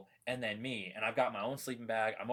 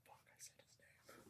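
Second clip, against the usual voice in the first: caught-out whisper; real hushed self-reproach; whispered aside; 29 dB quieter than usual. Real, not acted. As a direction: whisper it to yourself in dismay. Realizes he said name.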